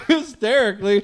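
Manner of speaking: ordinary delivery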